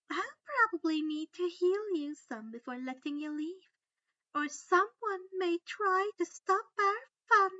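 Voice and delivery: high, loud, sweetly menacing; sugary cruel threat. A narrator's reading. Umbridge threatening Harry before raking his face. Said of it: "Umbridge chuckled".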